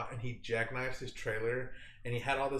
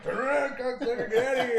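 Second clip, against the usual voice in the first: high, loud hammed-up threat; mock armed guard; 12 semitones higher, 6 dB louder, faster than usual. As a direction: bark it like a guard waving a gun. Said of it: "with his fucking Glock, like, just pointing it".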